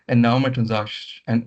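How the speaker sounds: ordinary delivery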